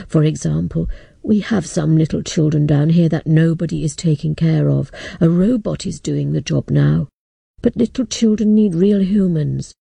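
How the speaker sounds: a sorry old man breaking bad news. Old man offers work.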